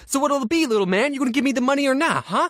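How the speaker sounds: high, quick mock intimidation; hammed-up hustler pressing for an answer; pushy shakedown. Seller pressures him with a threat.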